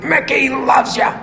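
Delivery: high, strained trainer voice, drawn out; put-on cry of rough affection; Mickey impression. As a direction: stretch it out as the old trainer. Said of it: "What does he say?".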